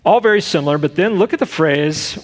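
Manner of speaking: ordinary delivery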